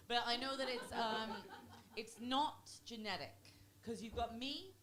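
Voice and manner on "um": ordinary talk, nothing marked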